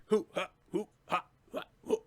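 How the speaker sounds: sharp hoo-ha fighting cries; karate grunts. Acted. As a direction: bark out little kung fu noises. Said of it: "You could see him like".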